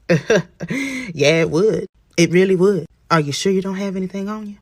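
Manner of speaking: loud, high, amused cop voice; laugh, then boom the question; laughing officer. Acted. Officer amused by passenger's denial, loudly knowing.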